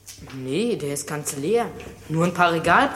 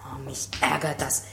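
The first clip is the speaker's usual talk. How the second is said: ordinary talk, nothing marked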